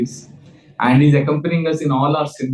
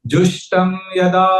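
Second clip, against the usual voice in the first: higher, measured verse line for repeating; slow recitation; 5 semitones higher than usual. Shown as a demonstration. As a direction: lift the pitch and hold the last vowel. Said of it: "say it slowly".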